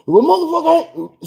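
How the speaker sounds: high-pitched imitated cussing; hammed-up furious girlfriend; mock rant. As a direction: squeal it like she's going off.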